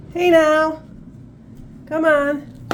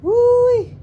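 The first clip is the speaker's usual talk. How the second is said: excited whoop; 10 semitones higher, 6 dB louder than usual. Real, not acted. Excited reaction to bird.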